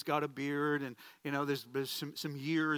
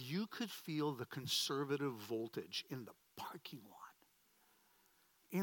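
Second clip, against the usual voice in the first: hushed emphasis; 3 semitones lower, 10 dB quieter, slower than usual. Real, not acted. Preacher tells of the charged atmosphere outside the venue, hushed. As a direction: drop almost to a whisper.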